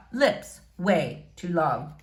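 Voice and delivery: usual speaking voice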